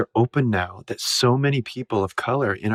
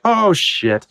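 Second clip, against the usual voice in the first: startled oh shit; 9 semitones higher, 3 dB louder than usual. Retold or imitated. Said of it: "and I'm like".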